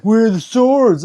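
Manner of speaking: loud, high, drawn-out mock demand; mock troll demand. Streamer mimics a troll demanding swords, loud mock yell. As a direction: imitate a troll calling out loudly.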